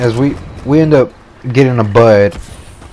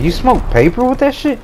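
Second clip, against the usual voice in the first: high, disbelieving squeak of a question; can't believe you'd smoke paper; incredulous; 13 semitones higher than usual. Retold or imitated. To his homeboy, shocked that weed is smoked in paper, high incredulous question.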